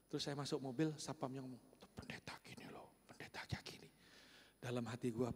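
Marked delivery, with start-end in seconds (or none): 1.8-3.9 hushed whisper; guard whispering praise of the pastor; whispered aside. Retold or imitated. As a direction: whisper it under your breath. Guard murmurs admiringly as pastor leaves.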